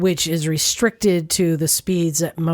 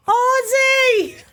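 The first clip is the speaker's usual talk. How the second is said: high shrill call across the house; Sharon Osbourne shout. Pretend. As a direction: yell for your husband. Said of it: "maybe Sharon Osbourne".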